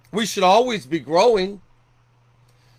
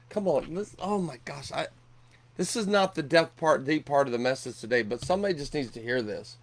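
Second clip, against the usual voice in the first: much softer, lower, moved; preacher moved, speaking much softer to the room; overcome aside; 5 semitones lower, 8 dB quieter than usual. Real, not acted. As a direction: drop to a hushed, moved aside.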